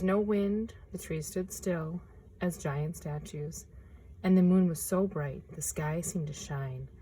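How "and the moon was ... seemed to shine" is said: ordinary delivery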